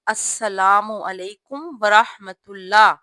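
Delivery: slow, every word of السلام علیکم ورحمۃ اللہ distinct; calm clear salaam. Shown as a demonstration. Teacher showing sisters the calm correct salaam, slow. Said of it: "سکون کے ساتھ، آرام سے اور واضح".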